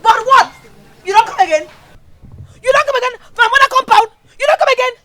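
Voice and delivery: loud, high-pitched furious yelling; angry threats. Acted. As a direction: lash out, shouting over everyone. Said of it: "You don't have to talk like that".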